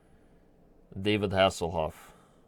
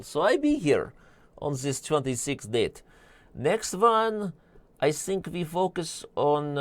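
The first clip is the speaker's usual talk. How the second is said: put-on lightly done broken-English accent, pitched up; mock broken-English accent; 3 semitones higher than usual. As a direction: play it as a hammed-up foreign speaker with dropped words. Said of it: "I've butchered enough accents today".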